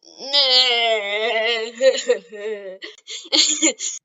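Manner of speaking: loud, drawn-out bratty "nyeh" breaking into laughter; mocking "nyeh". Bakugo taunting Deku, mocking noise into laughing. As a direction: jeer with a long nasty noise, then crack up. Mock taunt ending acted outburst.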